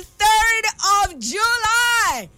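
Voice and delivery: high, slow, gushing exclamation; excited exclaim. Real, not acted. Host gushing over her birthday on air, slow and high. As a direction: draw it out in delight.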